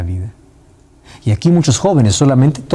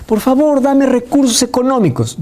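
high begging plea to the Lord; mock the needy pleading prayer; 10 semitones higher than usual. Preacher imitating a broke believer, high pleading. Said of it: "le dices".

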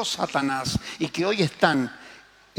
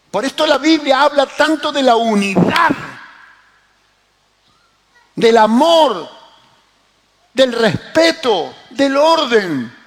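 loud, high, emphatic preaching; prédica enérgica; 6 semitones higher, 6 dB louder than usual. Acted. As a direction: ring out each item as a preacher would. Predica a plena voz. (predicando con voz fuerte y enfática)

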